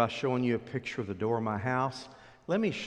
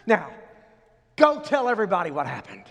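higher and louder, pushing them out the door; urgent command; 6 semitones higher, 9 dB louder than usual. Acted. Angel ordering the women to spread the news, loud and urgent. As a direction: send them running with the news.

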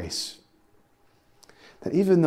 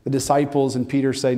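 ordinary talk

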